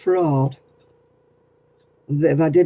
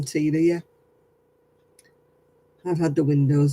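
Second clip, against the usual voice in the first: ordinary delivery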